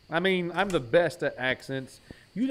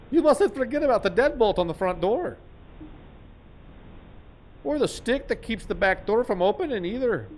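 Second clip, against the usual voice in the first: higher, louder hammed-up warning; mock warning voice; 7 semitones higher, 3 dB louder than usual. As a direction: warn like a silly narrator.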